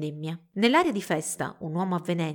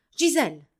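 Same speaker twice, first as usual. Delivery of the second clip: higher, louder sharp call; 5 semitones higher, 6 dB louder than usual. A narrator's reading. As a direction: shout her name to stop her. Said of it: "gridò".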